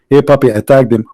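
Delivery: ordinary talk, nothing marked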